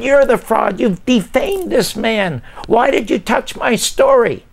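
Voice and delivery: high-pitched, furious accusation; screaming. Retold or imitated. Writer accusing the producer of defaming a man, screaming. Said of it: "I start screaming at him".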